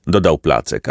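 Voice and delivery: plain reading voice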